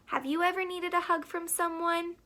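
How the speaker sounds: plain reading voice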